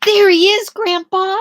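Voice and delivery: put-on high, loud little girl cry; excited girl. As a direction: squeal it out like a thrilled child. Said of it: "cried Melanie".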